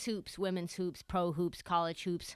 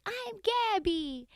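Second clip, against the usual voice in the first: put-on small high child voice, slow; Gabby; 10 semitones higher, 3 dB quieter, much slower than usual. Little girl tells the host her name, high imitated child voice. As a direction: answer slowly like a little girl. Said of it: "the girl's like".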